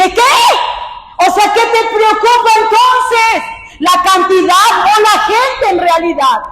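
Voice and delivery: high, incredulous retort; indignant disbelief. Retold or imitated. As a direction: challenge them in indignant, high-pitched questions. Retells her indignant reply.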